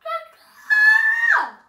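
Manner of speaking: shrieking, very high caw; imitating a crow's loud caw; bird cawing. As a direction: screech like a crow, slow and loud. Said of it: "And he's like".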